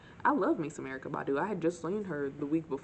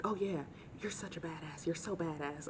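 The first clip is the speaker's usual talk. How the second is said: man's voice; 6 dB quieter than usual. Retold or imitated. Man voiced in story.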